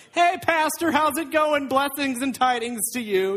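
high-pitched, hammed-up welcome; over-polite greeting. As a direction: greet the pastor with fake piety. Guests greet pastor with fake piety.

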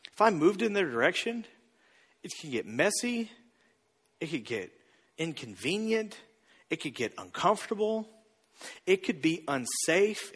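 soft, halting reluctance with long pauses; listing worries gingerly, piece by piece; acted hesitant objection. Congregant balking at reconciliation, hesitant and hushed.

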